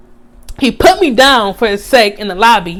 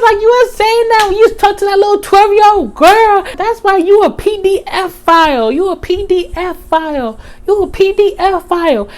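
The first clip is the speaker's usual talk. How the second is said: heated accusation; 9 semitones higher than usual. Retold or imitated. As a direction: keep yelling the accusation at him.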